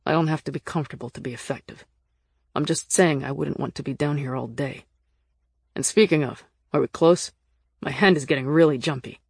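lightly voiced low male character, uneasy; uneasy Nick figure. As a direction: insist you can cope while on edge.

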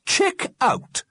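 high, disbelieving echo; disapproving echo. A narrator's reading. Missus Liberty queries the alderman's phrase, disapproving. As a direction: repeat it high, as if offended.

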